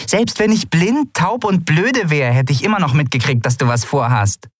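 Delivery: high-pitched, indignant character voice; scolding Nelen figure. A narrator's reading. Friend who saw through the pilot's plan, scolding.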